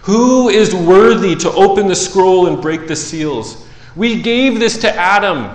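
high, loud angelic challenge; loud proclamation. Acted. Mighty angel calls for someone worthy, loudly proclaiming. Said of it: "proclaiming with a loud voice".

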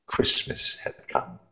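plain reading voice

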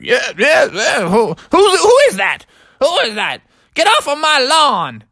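a grouchy grandpa yelling at intruders; cranky grandpa. Acted. Grandpa woken, grumpy.

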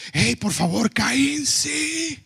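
high, rising parental scolding that builds to a shout; exasperated dad hushing his kids in public. Retold or imitated. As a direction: tell the noisy kids off in front of everyone. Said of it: "les tengo que decir".

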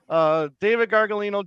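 ordinary delivery

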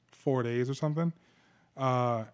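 ordinary delivery

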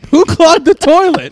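hammed-up shocked girlfriend; mock outrage. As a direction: shriek it in disgust. Role play discovering clogged toilet.

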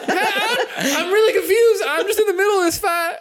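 high, squeaky bewildered bystander; a startled little guy blurts it out; confused passerby. Acted.

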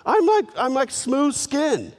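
high, whining protest to mom; whiny son. Retold or imitated. Jacob whining to his mother about the plan. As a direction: whine like a boy who can't win. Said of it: "he goes like".

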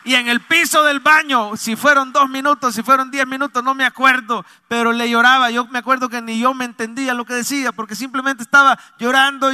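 high, loud testimony from the pulpit; impassioned preacher's voice; fervent preaching. Acted. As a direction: cry out the testimony to the church. Preaches at full force.

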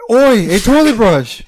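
loud British chef insult; mock Gordon Ramsay yell. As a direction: bark it like an angry TV chef.

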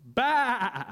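playful sheep noise; sheep bleat. A narrator's reading. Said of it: "Sheep said".

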